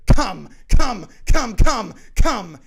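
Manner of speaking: short, repeated high yells; grunt-shout each word wildly; desperate, very loud chant; deranged shouting. Acted. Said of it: "grunting and shouting".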